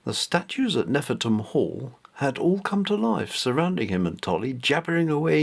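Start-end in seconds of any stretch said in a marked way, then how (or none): none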